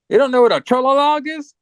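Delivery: high-pitched disbelieving question; mock incredulous. Boy asking her about the creatures, mock incredulous. Retold character baffled by her ignorance.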